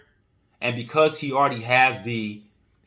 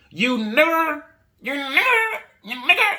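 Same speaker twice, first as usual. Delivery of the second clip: high, strained mocking slur; a high, contemptuous imitation of a bigot; hateful sneer; slower than usual. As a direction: spit it out in a shrill hateful mockery. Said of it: "And the way they say it too".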